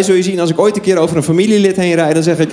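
usual speaking voice

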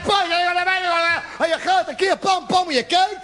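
high, agitated, relentless grilling; mock Gestapo interrogation. Jealous man grilling his girlfriend at home, high-pitched mock interrogation. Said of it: "Gestapo-verhoor".

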